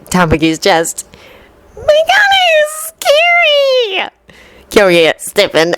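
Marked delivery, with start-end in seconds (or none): 1.8-4.1 put-on very high, slow little girl; drawn-out high child fright. A narrator's reading. Little Kirimi hiding from Kyoya, high child voice.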